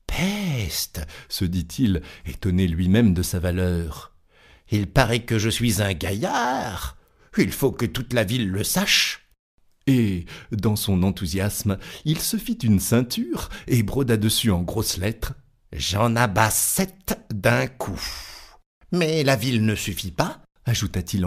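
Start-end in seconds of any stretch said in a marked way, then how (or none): 0.1-1.0 astonished. Acted. Tailor astonished at his kill. 4.7-9.3 lightly voiced proud little tailor; boastful little tailor. Tailor marvelling at his own valour, lightly boastful. 15.7-20.4 lightly voiced proud little tailor; boastful little tailor. Tailor marvelling at his own valour, lightly boastful.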